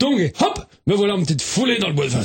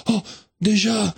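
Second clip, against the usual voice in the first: usual speaking voice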